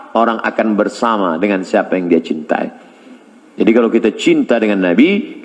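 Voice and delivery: ordinary talk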